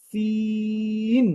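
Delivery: সিন as a long, high English "C" closed with n. Shown as a demonstration. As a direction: draw out সিন on one high note, then add the n. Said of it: "ঠিক সি এর মতো উচ্চারণ হয়". (ইংরেজি সি-এর মতো টেনে সিন)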